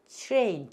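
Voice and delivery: TRAIN with tr blended into ch, "chrein"; model pronunciation. Shown as a demonstration. Teacher leading students to repeat 트레인, model pronunciation. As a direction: say 트레인 as "chwrein", one smooth glide. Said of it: "따라서 해보세요".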